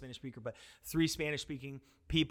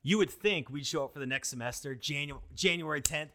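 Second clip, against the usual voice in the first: ordinary talk, nothing marked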